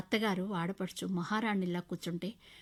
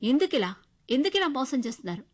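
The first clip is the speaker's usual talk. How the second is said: louder, pressing question; loud demand; 6 semitones higher, 8 dB louder than usual. Acted. Wife confronting husband's deceit, loud demanding question. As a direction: confront him hard. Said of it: "అంటూ గట్టిగా అడిగాను".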